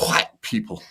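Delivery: whispery HWITE, leaning on the H; exaggerated H. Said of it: "make sure you emphasize the H".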